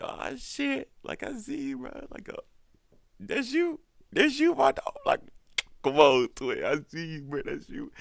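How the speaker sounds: squealing falsetto hype-up; hammed-up high-pitched congratulations; mock hype. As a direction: imitate friends cheering on their boy. Acts people hyping up Connell.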